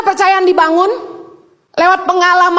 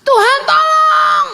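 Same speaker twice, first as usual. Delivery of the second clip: higher, slowed cry for help; desperate cry; 7 semitones higher, slower than usual. Retold or imitated. Mother crying out to God under stress, higher drawn-out plea. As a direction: plead like you're at the end of your rope. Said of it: "Udah stres saya tuh".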